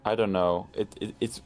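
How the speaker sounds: ordinary delivery, nothing marked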